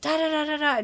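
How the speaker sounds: usual speaking voice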